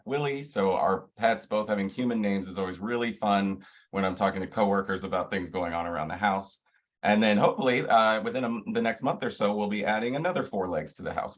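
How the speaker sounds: ordinary talk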